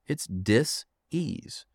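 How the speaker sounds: slow, with dis-ease cut into dis and ease; dis-ease drawn out and split in two; dis-ease split apart. Shown as a demonstration. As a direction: say dis-ease slowly, pausing between the two parts. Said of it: "That's where the word disease comes from".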